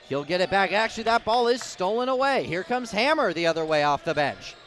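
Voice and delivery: rising shout over the fast break; excited play-by-play. Real, not acted. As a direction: call the steal loud and high. Fast break steal and layup.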